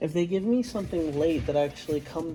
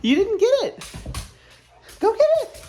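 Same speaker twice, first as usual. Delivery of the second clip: excited pet voice. Real, not acted. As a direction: cheer the dog on to fetch. Playing fetch with dog.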